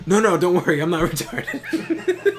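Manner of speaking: giggling through a mock reassurance, slow at the end; laughing reassurance. Telling the lifeguard not to worry, laughing mock reassurance. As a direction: laugh while insisting you're fine.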